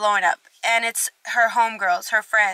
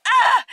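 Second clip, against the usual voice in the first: short high exasperated shout; frustrated yell; 13 semitones higher, 6 dB louder than usual. Retold or imitated. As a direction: let out a sharp ah of frustration. Frustrated outburst retelling.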